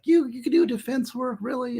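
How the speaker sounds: high, put-on voice of doubting bailiffs; incredulous bailiffs. Bailiffs doubting his switch to defense, high mock voice. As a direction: play the bailiffs who can't believe it, high. Bailiffs' mocking disbelief retold.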